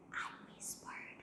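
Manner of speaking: soft unvoiced whisper, slow. Shown as a demonstration. Teacher acting out the song line for students, whispered. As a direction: breathe the words with no voice. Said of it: "Eu sussurrei bem baixinho".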